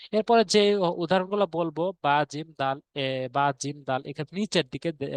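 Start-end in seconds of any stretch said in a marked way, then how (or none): none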